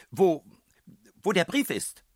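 high, loud, faltering; landlady suddenly unsure about the letter; startled shrill question. A narrator's reading. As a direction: caught off guard, voice jumping up.

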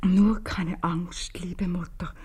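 slow breathless whisper of the note; whispered reading. Acted. Mother reading her daughter's note to herself, whispered slowly. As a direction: read the note under your breath, very slowly.

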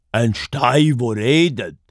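emphatic, doubtful question; disbelieving bear. A narrator's reading. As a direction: question it with heavy scepticism. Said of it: "sagt der Bär ungläubig". (ungläubige Bär)

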